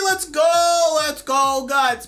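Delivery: high, loud cheering; celebrating. Real, not acted. Streamer cheering a viewer's two-year follow, high loud whoops. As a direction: whoop it up for the milestone.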